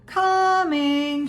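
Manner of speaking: high, drawn-out singsong answer; high call with the first syllable held; shouted reply. A narrator's reading. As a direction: sing it back long across the distance. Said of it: "she shouted".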